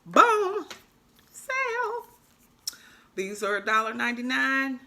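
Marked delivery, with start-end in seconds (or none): 0.0-0.7 high, loud triumphant boom; excited boom. Real, not acted. Crafter celebrating her bargain, triumphant burst. 1.3-2.1 high put-on squeal; squeaky sale call. Crafter squealing about the sale, high put-on voice.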